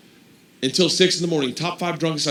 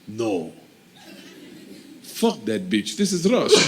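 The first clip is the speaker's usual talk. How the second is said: cop's verdict; 3 semitones lower than usual. Retold or imitated. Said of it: "and he goes".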